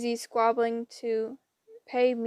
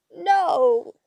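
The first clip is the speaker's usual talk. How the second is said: loud, high screamed denial; indignant old-lady shriek; 5 semitones higher, 7 dB louder than usual. A narrator's reading. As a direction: scream back from behind the wheel. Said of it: "screamed Wasp".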